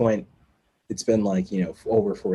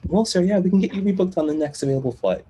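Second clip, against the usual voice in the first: higher-pitched customer service voice; imitated help desk agent; 8 semitones higher than usual. Help desk agent rebooking a passenger, imitated service voice. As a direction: put on an obliging agent's voice.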